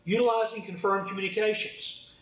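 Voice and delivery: ordinary delivery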